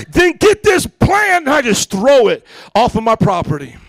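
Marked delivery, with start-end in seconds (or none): 0.1-1.5 loud, high, drawn-out demand; hammed-up confrontational shout; retold angry order. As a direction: holler a slow, indignant command at the neighbor. Acted confrontation with neighbor Bob.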